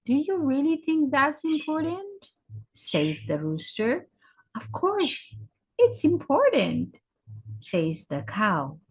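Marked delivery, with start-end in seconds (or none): 0.1-2.0 lightly voiced rooster, high and quick; high, quick questioning rise; doubting rooster. Rooster questions the cow at his job interview, doubting. 4.5-7.0 lightly voiced cow, pitched high; insistent cow. Cow answers the rooster candidate, insistent.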